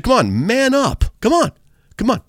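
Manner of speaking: high-pitched calling-out dare; retold goading. Preacher daring a driver to step out, retold goading. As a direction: goad someone from across the street.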